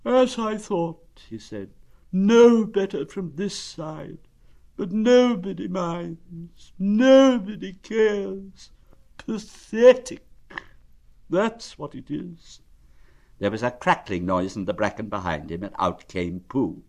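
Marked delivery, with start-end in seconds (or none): 0.1-1.2 high, drawn-out lament; slow, high donkey voice of self-pity; gloomy donkey. Acted. Donkey looking at himself in the water, gloomy. 2.1-12.6 high, slow, self-pitying lament; gloomy donkey. Acted. Donkey complaining that nobody cares, gloomy.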